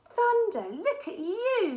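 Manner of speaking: high, loud, slowed squeal; a girl thrilled by her cat's spacesuit; delighted scream. A narrator's reading. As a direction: shriek with joy at the cat. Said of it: "she screamed".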